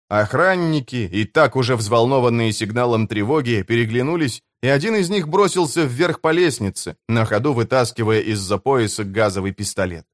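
plain reading voice

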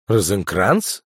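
«Розенкранц?» asked back with a rising, questioning tune; surprised echo. Acted. Kukurator repeats the name back to the spy chief, incredulous. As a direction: echo «Розенкранц?» in mild disbelief. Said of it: "переспросил Кукуратор".

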